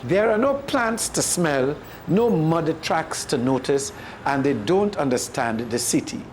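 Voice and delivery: usual speaking voice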